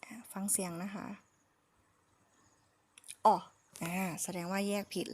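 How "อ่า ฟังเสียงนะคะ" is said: whisper. Real, not acted. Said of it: "whispers before playing audio".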